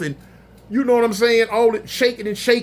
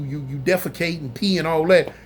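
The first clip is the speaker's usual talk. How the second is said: usual speaking voice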